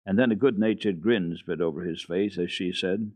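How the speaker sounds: ordinary delivery, nothing marked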